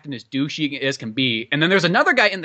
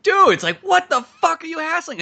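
high, louder exasperated protest; indignant outburst; 11 semitones higher, 5 dB louder than usual. Retold or imitated. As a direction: protest like you're fed up. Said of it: "it's just like".

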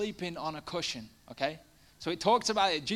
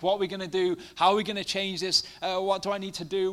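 higher, louder mock panic; imitating panic; 5 semitones higher, 7 dB louder than usual. People facing crisis in life, mock panic. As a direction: rattle off the worries in a fluster. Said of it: "so often we panic".

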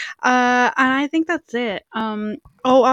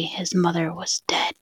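whispering; 4 semitones lower, 7 dB quieter than usual. Acted. Ghost story ending whispered.

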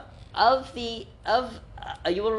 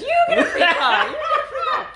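much higher, louder hammed-up Oprah, laughing; Oprah impression; 5 dB louder, faster than usual. Host picturing car signs, shrieking mock Oprah. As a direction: squeal it like a giddy Oprah giveaway. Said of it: "signs on the side that says".

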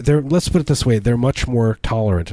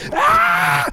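sudden high shriek; scream. Pretend. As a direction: let out a short horrified scream. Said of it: "I scream".

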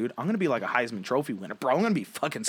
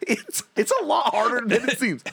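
usual speaking voice

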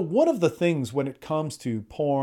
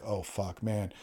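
much lower and softer, under the breath; deflated regret; 6 semitones lower, 10 dB quieter, slower than usual. Acted. Man to himself after bad sex, deflated. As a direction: sink into a quiet, low groan of regret.

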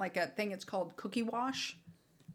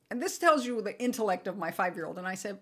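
normal talking voice

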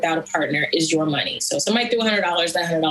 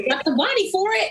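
mom's reply; 12 semitones higher, faster than usual. Retold or imitated. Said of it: "she's like".

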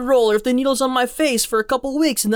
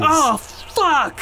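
mock alarm; 4 semitones higher than usual. Player reacts to being trapped.

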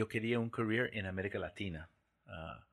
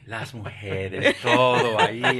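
dreamy excitement; 11 dB louder, slower than usual. Retold or imitated. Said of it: "Ya estaba ahí imaginando".

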